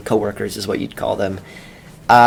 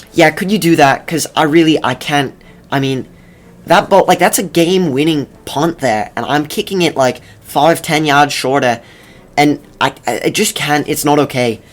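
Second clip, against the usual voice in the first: put-on lightly held Australian accent, a bit higher; Aussie punter pleading, in a weak Australian accent; a fading attempt at an Australian accent; bad Australian accent; 4 semitones higher, 4 dB louder than usual. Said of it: "That was not a good Australian accent".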